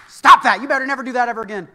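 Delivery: sudden loud furious dad shout, fast; harsh dad yelling. Retold or imitated. Dad scolding his son with a loud angry shout. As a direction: bring the hammer down on the kid. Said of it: "come down as a dad with a hammer".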